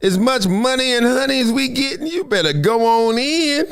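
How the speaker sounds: a hint of laid-back Snoop; imitating [name]. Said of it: "He probably said".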